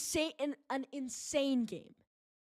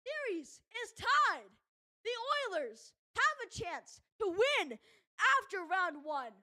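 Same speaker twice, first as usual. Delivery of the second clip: hoarse, worn-out voice after shouting; strained hoarse voice, running out; voice dying; 6 semitones higher than usual. Real, not acted. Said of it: "My voice is dying".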